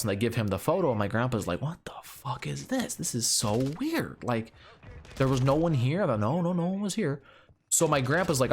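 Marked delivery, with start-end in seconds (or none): 1.6-6.1 a hint of a baffled grandpa, mostly own voice; lightly voiced bewilderment, first line hushed; grandpa spooked. Grandpa puzzling over the ghost photo, lightly voiced and bewildered. 6.1-7.2 high insistent voice; insisting. Retold or imitated. Insisting to grandpa that nobody was home, high and insistent.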